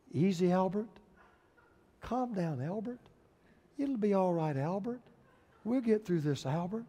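soft, measured soothing murmur; very calm. Acted. Dad talking through a toddler's tantrum, very calm. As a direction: soothe with patient, hushed restraint. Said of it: "this dad was just very calmly saying".

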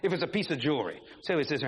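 usual speaking voice

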